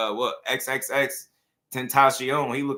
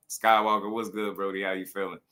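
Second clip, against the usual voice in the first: ordinary talk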